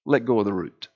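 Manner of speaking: a hint of a deep divine command; God speaking down the well; God's voice. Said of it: "God hollers down".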